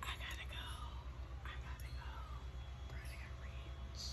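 soft whispered panic to leave; whispering that she has to leave before buying; urgent whisper. Real, not acted. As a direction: whisper it like fleeing temptation. Overwhelmed by tempting ornaments.

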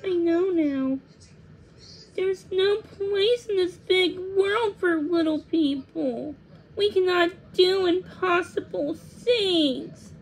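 high, slow, tearful lament; sad and confused. A narrator's reading. Thumbelina despairs beside the swallow, sad and confused. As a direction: give up in a high, mournful voice. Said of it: "sad and confused".

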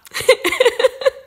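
high, loud burst of giggling; high loud giggle. Real, not acted. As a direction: giggle at yourself. Laughs at her own joke.

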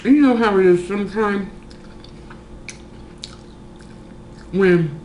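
food in mouth; licking fingers between words; talking while chewing. Real, not acted. Seen 4: Bit taco at -4; mouth working food, then fingers at lips.

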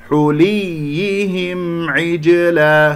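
"حليهم عجلا" chanted slowly with the م fully sounded; clear م. Shown as a demonstration. Teacher asking if they heard it, slow chant with clear م in "حليهم". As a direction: make the م of "حليهم" distinct before "عجلا". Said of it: "سمعتم صوت الميم مظهرة".

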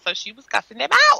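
usual speaking voice

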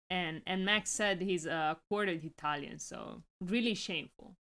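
ordinary talk, nothing marked

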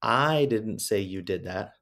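slow, deliberate read with weight on I; stress on I. Shown as a demonstration. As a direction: hit the word I hard, as if blaming someone else. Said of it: "but said four different ways".

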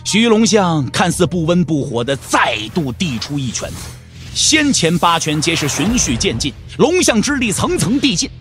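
ordinary delivery, nothing marked

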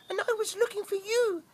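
high squeaky chick voice; cheeping chick. Acted. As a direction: peep it like a lost chick. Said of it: "cheeped little chick".

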